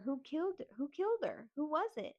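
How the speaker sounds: high, repeated urgent demands; insistent questioning. Retold or imitated. Niece interrogating aunt about the cousin's killer, insistent. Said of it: "So I keep questioning her".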